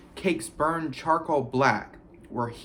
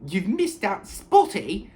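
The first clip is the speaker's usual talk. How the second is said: hissing Peeves; 7 semitones higher, 4 dB louder, slower than usual. A narrator's reading. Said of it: "Peeves hissed in her ear".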